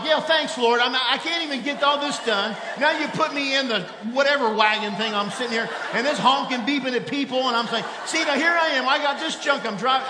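high, loud exasperated rant at God, half laughing; frustrated shout. Retold or imitated. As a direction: yell it like a fed-up complaint to the Lord. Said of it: "And then I'm like".

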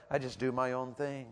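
lightly voiced sullen teen; mumbled kid voice. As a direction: mimic the kid's shrug of a line. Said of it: "He said, uh".

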